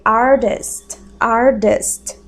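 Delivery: "artist" with the t like a d, ardist. Shown as a demonstration. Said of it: "Esse T a gente fala com som de D". ("artist" com o T soando como D)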